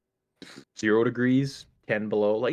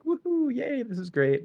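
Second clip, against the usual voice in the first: mock cheer; 12 semitones higher than usual. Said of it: "then we were all like".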